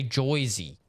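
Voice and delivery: "Joyzy" with "oy" in place of "er"; Joyzy for Jersey. Retold or imitated. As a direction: say Jersey as "Joyzy", JOY-zee. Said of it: "Like, to say Joyzy".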